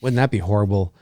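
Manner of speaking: ordinary talk, nothing marked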